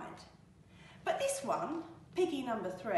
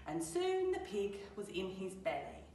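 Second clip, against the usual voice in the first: usual speaking voice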